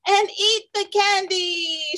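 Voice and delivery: high, slow gleeful shout; excited shout. Acted. Samson shouting to Clara about candy, gleeful. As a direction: call it out with glee. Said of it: "shouted Samson".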